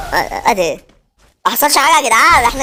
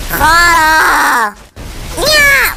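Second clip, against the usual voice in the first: yelling insult; much slower than usual. Real, not acted. Gamer yelling at opponent.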